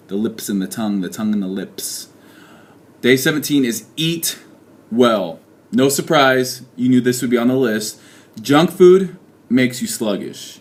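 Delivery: usual speaking voice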